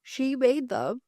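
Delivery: talking through a yawn; words stretched by a yawn. Real, not acted. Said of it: "Pardon me, as I yawn".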